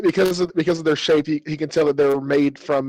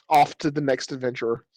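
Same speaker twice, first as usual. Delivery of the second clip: normal talking voice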